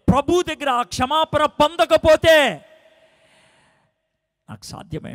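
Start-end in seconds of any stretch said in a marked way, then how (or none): 0.1-2.7 much higher and louder, slowed, fervent; preaching at full force. Acted. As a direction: drive the point home from the pulpit. Preaching climax.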